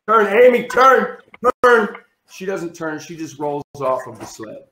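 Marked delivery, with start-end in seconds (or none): 0.1-2.0 high, loud, urgent calling out; frantic yelled warning. Retold or imitated. Yelling at Amy to turn before the street, frantic shout. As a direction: yell it down the hill to a friend. Panicked warning to friend on sled.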